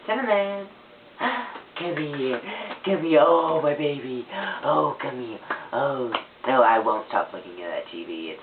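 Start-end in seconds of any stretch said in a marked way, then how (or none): 0.1-1.2 high delighted call and gasp; light up at the sight of the pet; excited call. Real, not acted. Spotting Cinnamon, a high excited call and gasp. 1.8-6.1 cooing at pet. Real, not acted.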